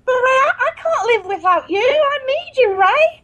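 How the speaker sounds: high, melodramatic begging; pleading assistant. Acted. Assistant begging Ray to have her back, pleading.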